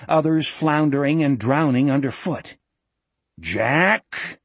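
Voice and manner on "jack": drawn-out bellowed name, rising. A narrator's reading. Uncle Morgan calling Jack over the noise, bellow. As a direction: call out over a ripping noise. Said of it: "a voice bellowed over".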